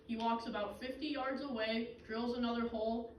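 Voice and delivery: usual speaking voice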